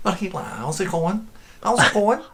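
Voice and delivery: high-pitched Liverpool greeting; exaggerated Scouse accent. Shown as a demonstration. Guide practising the accent off-take, high exaggerated Scouse. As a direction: pitch up a hammed Scouse hello. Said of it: "Can you do Scouse accent?".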